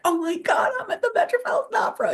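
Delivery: high, overwhelmed with wonder; breathless, teary amazement; awestruck. Retold or imitated. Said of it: "and I'm like".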